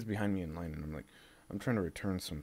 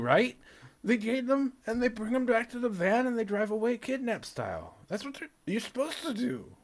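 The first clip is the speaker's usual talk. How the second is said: much higher, breezy know-it-all; mock naive internet voice; 12 semitones higher, 4 dB louder than usual. The internet explaining its scheme to him in a high, breezy know-it-all voice. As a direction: explain it like it's obvious.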